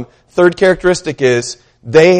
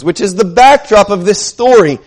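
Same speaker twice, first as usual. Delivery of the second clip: ordinary talk, nothing marked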